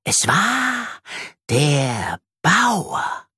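slow, drawn-out suspenseful reveal; high, secretive spider voice; mysterious. Acted. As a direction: stretch each word to build mystery. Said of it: "erzählt sie geheimnisvoll".

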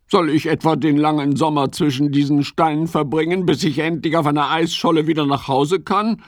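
fast, grumbling frog question; grumpy. Acted. Ole grumbles at Fips's idea, fast and grumpy. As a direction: grumble it out quickly. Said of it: "fragt er mürrisch".

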